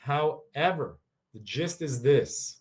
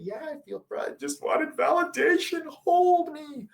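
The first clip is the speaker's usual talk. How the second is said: high, squeaky mock whimper; whiny partner; 3 dB louder, slower than usual. Partner begging for comfort, squeaky mock whine. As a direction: beg to be held in a silly high voice.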